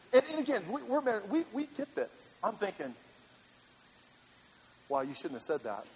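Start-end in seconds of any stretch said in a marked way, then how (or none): 4.9-5.8 low, quiet aside to himself; retold inner thought. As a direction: think it under your breath, low. Retold private thought about wife's remark.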